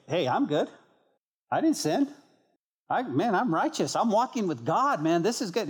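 high, swooping, smug put-on voice; mock self-righteous. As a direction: brag like someone sure he's sinless, hammed up. Mock self-righteous man refusing confession.

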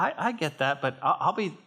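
usual speaking voice

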